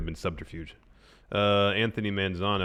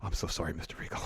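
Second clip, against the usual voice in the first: meek apology; 2 semitones lower, 9 dB quieter, faster than usual. Retold or imitated. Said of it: "Sid turns to William Regal and goes".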